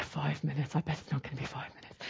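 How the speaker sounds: soft, low muttered aside; quiet grumble to yourself; sceptical aside. Retold or imitated. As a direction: mumble it under your breath, doubtfully.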